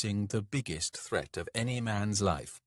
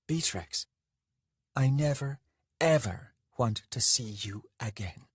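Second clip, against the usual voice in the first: raised pitch, emphatic pauses between phrases; angry finality; 3 semitones higher than usual. Acted. Lover leaves Beatrix after their fight, angrily final. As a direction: cut him off for good, deliberately.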